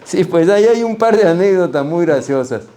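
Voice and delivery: Sí, pues ahí hay un par de anécdotas muy graciosas said high and loud; eager. Real, not acted. Answering the interviewer, eager and loud. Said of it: "repeated line".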